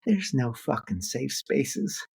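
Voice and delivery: high, slow honeyed Southern grandma voice; a sweet Southern grandma setting a young activist straight; sweet Southern granny. Retold or imitated. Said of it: "in her best sweet Southern granny voice".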